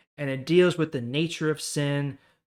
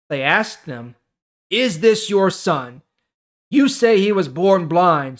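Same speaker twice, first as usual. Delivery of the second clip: louder, clear Bible reading voice; scripture reading; 3 semitones higher, 8 dB louder than usual. Acted. YouTuber reading John chapter nine to his viewers, projected scripture reading. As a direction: read the chapter out like a preacher.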